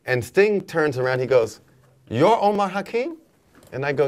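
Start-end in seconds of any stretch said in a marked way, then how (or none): 2.1-3.2 high, incredulous double-check; surprised question. Retold or imitated. Sting recognizing the drummer he was seeking, acted out surprised. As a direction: act out startled recognition of a name.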